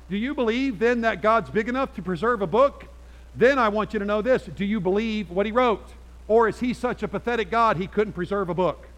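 loud, high preaching push; preaching at full force. Acted. Pastor pressing the congregation, preaching at full force. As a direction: drive it home from the pulpit.

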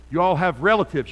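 ordinary delivery

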